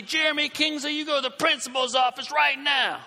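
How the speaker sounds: loud, high mock teacher yell. Teacher sending the student to the principal, a mock yell. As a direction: order him out, loudly. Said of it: "the teacher yells at me".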